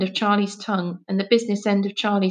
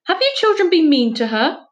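high, loud accusing demand; furious; 9 semitones higher, 5 dB louder than usual. Acted. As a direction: scold the children furiously. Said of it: "asked Missus Lamb furiously".

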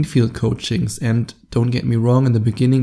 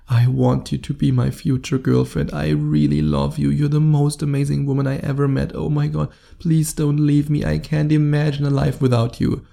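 needy pleading; 2 semitones higher than usual. Acted. Guy confessing love on the first date, needy pleading. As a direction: plead like a hopelessly smitten guy. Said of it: "in a way that's not needy".

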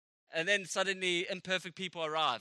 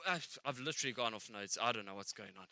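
quiet flustered aside; 12 semitones lower, 8 dB quieter than usual. Real, not acted. Lost his notes, aside.

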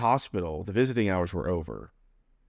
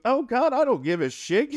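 high, loud mom voice, brushing it off; imitating mom; 4 dB louder than usual. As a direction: squeal it out, dismissive and high. Mom voiced in hospital story.

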